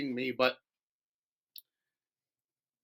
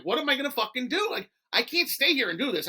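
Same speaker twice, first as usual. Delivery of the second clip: higher, hurried, exasperated plea; exasperated venting; 7 semitones higher than usual. Retold or imitated. Man venting to Tony about being bullied, exasperated. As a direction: retell your helpless complaint, voice pitched up.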